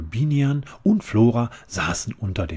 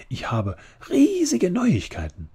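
excited; 11 semitones higher, 5 dB louder than usual. A narrator's reading. Amalia bursting with news.

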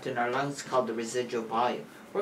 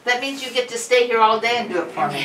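laughing; 7 semitones higher, 7 dB louder than usual. Real, not acted. Jokes after the test.

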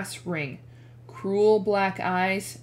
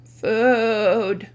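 moaning; 6 dB louder than usual. Acted. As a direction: moan it with no strength left. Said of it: "Grover moaned".

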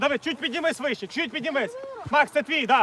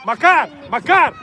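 loud repeated name call; 10 dB louder, slower than usual. Real, not acted. Coach calling Макар on the field, loud. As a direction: shout his name twice across the pitch.

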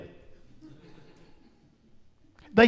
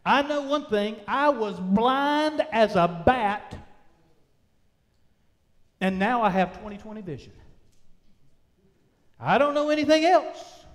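full-force preaching; 2 semitones higher, 4 dB louder than usual. Acted. Healed man answering his accusers, preached at full force. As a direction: preach it out, stretching the key words.

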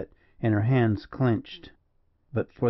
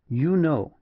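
stern opening, pitched up; stern but courteous; 5 semitones higher than usual. A narrator's reading. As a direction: address them sternly yet politely. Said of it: "he said sternly, but not uncourteously".

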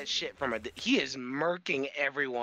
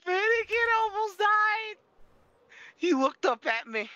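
excited; 13 semitones higher, 4 dB louder than usual. Real, not acted. Retelling the escape, hyped.

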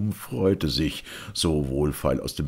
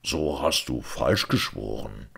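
ordinary delivery, nothing marked

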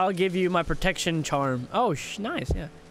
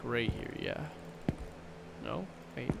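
ordinary talk

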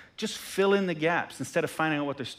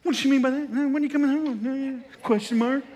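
suspicious inner voice; 8 semitones higher than usual. Retold or imitated. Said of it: "I read this text".

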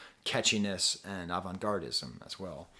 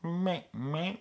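higher, nasal bleating meh; mimicking the sample's meh; Beaker meh; 6 semitones higher than usual. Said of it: "I look like a beaker".

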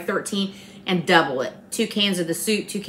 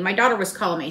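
normal talking voice

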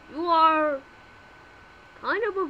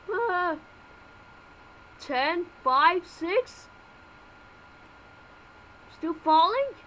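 ordinary talk, nothing marked

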